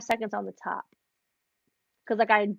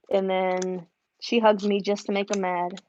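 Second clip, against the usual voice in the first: ordinary talk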